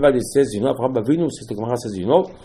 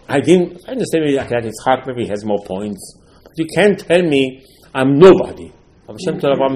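ordinary talk

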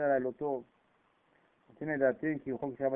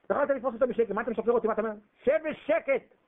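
loud scolding; 9 semitones higher, 5 dB louder, faster than usual. Acted. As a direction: tell him off, sharp. [name] scolding Laban loudly.

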